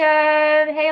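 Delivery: ordinary talk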